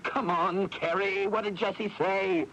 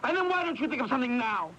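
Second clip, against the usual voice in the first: higher, rising accusing outburst; angry accusation; 8 semitones higher than usual. Acted. Younger brother turning on his brother, angry and accusing. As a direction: lash out at him for not caring. Brothers quarrel over chairs.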